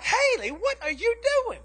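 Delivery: high-pitched, exasperated dad; retold fatherly dismay, very high; alarmed scolding. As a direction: catch your child mid-mess, voice shooting up. Dad finds daughter's bloody mess, scolds.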